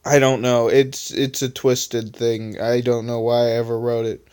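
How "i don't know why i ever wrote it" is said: ordinary delivery, nothing marked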